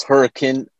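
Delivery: Hurricane said as HURRI-kun; local pronunciation. Shown as a demonstration. Guest answering how the town is said, Hurricane ending in short kun. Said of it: "pronounced hurricane because we're Utah".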